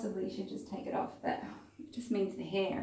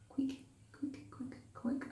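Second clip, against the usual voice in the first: Kiwi accent; 6 semitones higher, 8 dB quieter, slower than usual. Shown as a demonstration. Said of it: "my Kiwi accent then".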